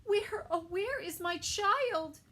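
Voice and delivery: high shocked mother's gasp and cry; gasp, then panic high; startled gasp. Acted. Sal's mother discovering a bear, gasping high. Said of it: "turned around and gasped".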